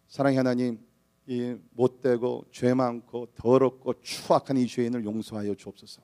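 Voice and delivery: slow, hushed, pleading prayer; humble yourself and beg forgiveness slowly; earnest humble prayer. Retold or imitated. Young believer praying alone in empty church, slow hushed plea. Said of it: "혼자 간절하게".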